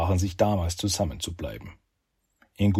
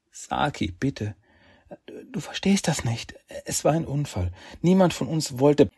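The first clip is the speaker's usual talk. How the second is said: pleading heroine; 7 semitones higher than usual. Acted.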